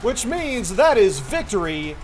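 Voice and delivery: loud, high-pitched stadium announcer; excited arena commentary with stretched-out key words. Acted. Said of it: "this announcer voice".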